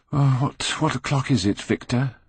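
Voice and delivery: sleepy, just-woken voice; drowsy. A narrator's reading. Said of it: "asked Dorian Gray drowsily".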